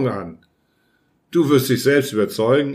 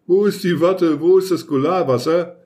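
higher, dazed voice of a beaten man; groggy mumble after a knock on the head; dazed mumbling; 5 semitones higher than usual. A narrator's reading. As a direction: mumble it while reeling from the blow. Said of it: "schwankenden Schrittes ins Bad ging und murmelte".

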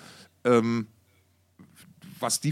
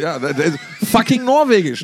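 loud exasperated outburst; 6 semitones higher, 11 dB louder than usual. Real, not acted. Exasperated over band name spelling.

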